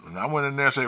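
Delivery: ordinary delivery, nothing marked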